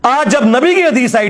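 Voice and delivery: ordinary delivery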